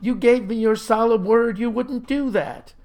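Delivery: high-pitched wounded protest; indignant accusation. A narrator's reading. Flustered boy accuses the farmer.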